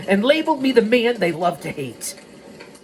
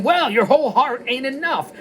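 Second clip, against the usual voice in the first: higher-pitched dismissive comeback; acted retort; 7 semitones higher than usual. Piper answering Frankie's excuse, dismissive retort. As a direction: throw it back at him, pitched up.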